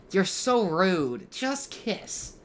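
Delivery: high, slow, pleading whine; exasperated fan whine. Real, not acted. Fan pleading with the characters to kiss, high whine. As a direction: beg them to just kiss, drawn out. Fan yelling at a teasing scene.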